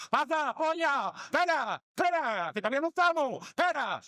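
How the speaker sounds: frantic shouts to hold the game; bingo yells. Retold or imitated. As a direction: yell it out as he waves everyone to wait. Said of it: "pega cuatro gritos en el bingo".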